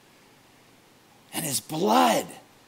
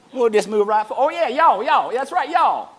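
put-on southern accent; 4 semitones higher, 6 dB louder than usual. Pastor joking with the class in a loud Southern accent. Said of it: "A southern accent".

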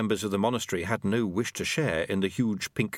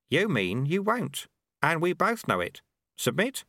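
put-on a higher, sly old monk voice; old sweeper Lutze; 6 semitones higher than usual. A narrator's reading. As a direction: tease him like a cheeky old master.

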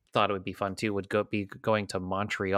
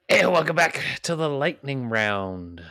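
announcer voice; 3 semitones higher, 4 dB louder than usual. Acted. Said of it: "show host returns from break".

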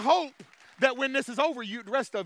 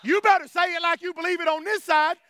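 raised, forceful correction; insistent preaching; 6 semitones higher, 7 dB louder than usual. Real, not acted. Preacher correcting a weak response, insistent preaching. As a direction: push the congregation to mean it.

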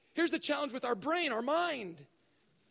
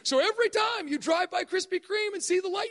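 ordinary delivery

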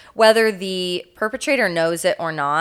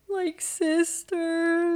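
much higher, softer and very slow; meek, broken voice; 11 semitones higher, 5 dB quieter, much slower than usual. Retold or imitated. Randy telling the cops about the girls, small and slow. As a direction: stretch it out, small and lost. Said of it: "he goes".

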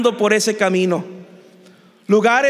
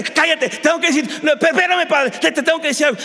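higher-pitched, stumbling, hurried protest; flustered insistence; 6 semitones higher, faster than usual. Acted. Prodigal son interrupted by his father mid-apology, flustered. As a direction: cut in urgently, tripping over the words.

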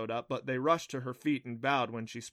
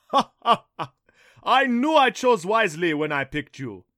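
big laugh into loud, high boasting; jovial thunder god; 7 semitones higher, 12 dB louder than usual. A narrator's reading. Thunder god congratulates Adam on his choice, booming heartily. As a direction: boom it with triumphant laughter.

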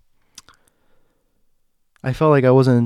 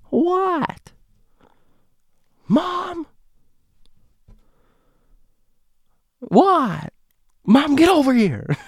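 much higher, far-off calling voices; calling across rooms; much slower than usual. Retold or imitated. Mom and daughter call between rooms, high. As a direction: throw the voice to another room.

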